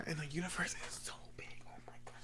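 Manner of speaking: hushed whisper of awe. Real, not acted. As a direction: whisper it as if overwhelmed by the size of the universe. Pondering the vast universe.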